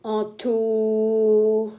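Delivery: "En tau" stretched, each syllable held; sounding out syllables. Shown as a demonstration. Teacher blending sounds for the class, drawn-out phonics. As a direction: draw out "En tau" slowly, syllable by syllable. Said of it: "Petit piège".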